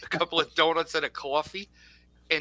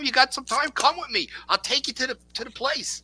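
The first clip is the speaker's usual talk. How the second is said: higher, excited push to come along; eager invitation; 9 semitones higher than usual. Retold or imitated. Friend urging him to come see the shop, excited.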